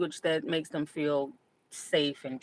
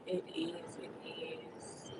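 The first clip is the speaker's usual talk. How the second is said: whispered; 15 dB quieter, much slower than usual. Real, not acted. Muttered agreement to herself.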